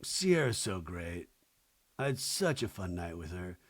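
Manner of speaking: low, soft dopey boyfriend imitation; mock boyfriend, low and subdued; boyfriend's part. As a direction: imitate him, voice dropped low and quiet. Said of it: "And then he goes".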